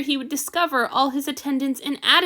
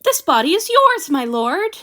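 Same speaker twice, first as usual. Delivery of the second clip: high, loud, ardent declaration to his lord; fervent cry; 4 semitones higher, 6 dB louder than usual. Acted. Servant crying out devotion to his god master, loud and high. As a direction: throw it out with eager devotion.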